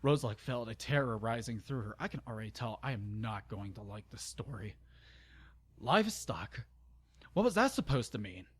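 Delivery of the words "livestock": plain reading voice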